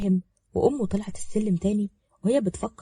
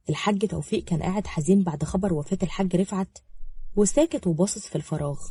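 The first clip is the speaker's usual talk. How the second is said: plain reading voice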